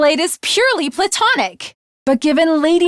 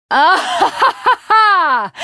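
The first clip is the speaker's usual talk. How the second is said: victorious "ha ha ha"; proud, hammed-up victory laugh; triumphant laugh; 4 semitones higher, slower than usual. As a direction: laugh in triumph over the fallen foe.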